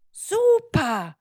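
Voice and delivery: high, loud cheer; delighted. Acted. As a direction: exclaim it as an enthusiastic magic schoolbag. Schoolbag cheers the boy's answer. (begeistert ausgerufen)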